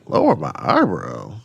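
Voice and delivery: low, puzzled repeat; puzzled echo. Retold or imitated. Repeating Matthew's tip in a low, puzzled voice. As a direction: drop the voice low, baffled. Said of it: "I was like".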